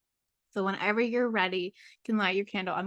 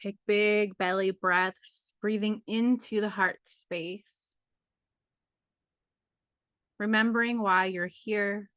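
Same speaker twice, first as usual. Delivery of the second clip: guided meditation. Acted. Guide leading a full moon journey into the heart, guided meditation. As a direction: ease listeners inward, phrase by unhurried phrase.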